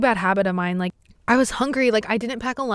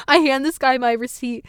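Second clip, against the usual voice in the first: ordinary delivery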